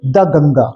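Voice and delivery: द said as short "da" before Ganga; model pronunciation. Shown as a demonstration. Teacher to students, modelling the "da" sound. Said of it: "इसको दी नहीं पढ़ेंगे बल्कि दा पढ़ेंगे".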